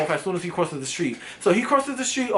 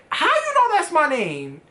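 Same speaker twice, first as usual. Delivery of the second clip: shocked gasp; 8 semitones higher, slower than usual. Retold or imitated. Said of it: "I fucking gagged".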